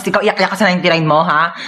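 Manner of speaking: ordinary delivery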